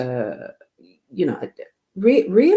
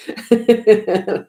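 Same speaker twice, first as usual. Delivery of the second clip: a quick high giggle, louder; bright laugh; 7 semitones higher, 7 dB louder than usual. Real, not acted. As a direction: laugh at your own joke. Laughing at Cinderella midnight joke.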